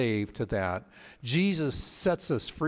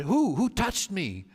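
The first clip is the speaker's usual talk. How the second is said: Jesus turns and demands who touched him, startled; surprised question; 7 semitones higher than usual. Retold or imitated. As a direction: spin round asking who.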